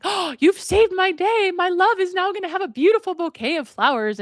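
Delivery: high-pitched gushing relief; hammed-up overjoyed lover; excited. Man thanking old woman for flowers, excited.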